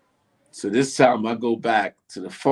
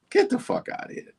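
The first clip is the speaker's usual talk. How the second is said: high, breathy get out of here; airy, high-pitched shock; disbelief; 4 dB quieter than usual. Retold or imitated. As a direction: squeak it out in total disbelief. Disbelief at a prank call.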